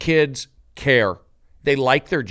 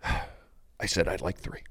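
very soft, low confession; hammed-up tender drama, hushed; mock-solemn hush; 6 semitones lower, 10 dB quieter, slower than usual. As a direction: say it gravely, barely aloud. Emotional scene about coffee creamers.

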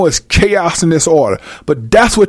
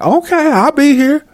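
woman voice; 9 semitones higher than usual. Acted. Woman answering in acted scene.

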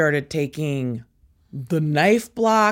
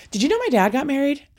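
high, very fast phone gossip; re-enacted tattling, rushed and high; breathless tattling; 7 semitones higher, much faster than usual. Daughter phones dad's girlfriend, rapid high tattling.

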